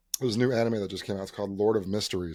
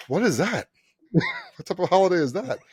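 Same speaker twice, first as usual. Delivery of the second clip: higher, quizzical imitation; puzzled older man; 7 semitones higher than usual. Older guy asking receptionist about holiday, puzzled imitation. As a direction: question it in mock bafflement.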